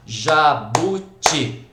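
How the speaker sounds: Jabuti and Paletó very slow, each syllable separate and firm. Shown as a demonstration. Teacher clapping palms to divide words, split syllable by syllable. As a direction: clap out Ja-bu-ti, Pa-le-tó. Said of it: "em alguns casos é bater palmas".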